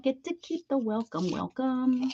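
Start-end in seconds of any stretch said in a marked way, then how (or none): none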